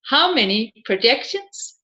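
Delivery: ordinary talk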